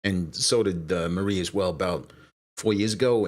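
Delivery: ordinary talk, nothing marked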